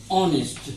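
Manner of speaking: ऑनेस्ट said softly with a silent h, as "on-est"; quiet, careful demo of ऑनेस्ट; pronunciation demo. Shown as a demonstration. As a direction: drop the h in ऑनेस्ट and open on "aw". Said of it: "उच्चारण कुछ इस तरह से होगा".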